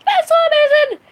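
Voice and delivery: high, loud delighted squeal; excited squeal. Real, not acted. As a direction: squeal with joy. Celebrating two hundred subs.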